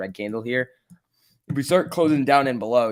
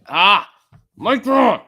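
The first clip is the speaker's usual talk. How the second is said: real high, loud outburst; frustrated yell; 10 semitones higher, 11 dB louder, much slower than usual. Real, not acted. Trader yelling at his stock Micron, frustrated shout. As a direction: yell it out, much higher and louder.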